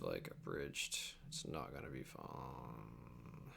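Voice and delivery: low, slow, sinking dread; low groan of dread. Real, not acted. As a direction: let the words sag in weary dread. Groaning about editing pain.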